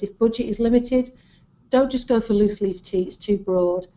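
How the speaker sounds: usual speaking voice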